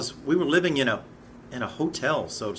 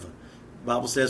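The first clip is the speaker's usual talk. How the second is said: ordinary talk, nothing marked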